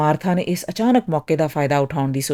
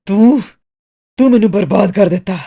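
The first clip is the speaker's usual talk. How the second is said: loud, furious outburst; screamed accusation; 3 semitones higher, 7 dB louder than usual. A narrator's reading. Customer pounding Miss Martha's counter, yelling angrily. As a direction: shout the accusation, bursting out on the first word. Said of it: "ਮੁੱਕੇ ਮਾਰਦਿਆਂ ਚੀਖਿਆ".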